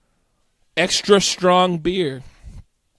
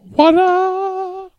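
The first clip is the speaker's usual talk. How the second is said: loud, high, long-held whoop; hype call; 13 semitones higher, 7 dB louder than usual. Pretend. Co-host hyping each beer listed, high whooping. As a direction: sing it out high, holding the end.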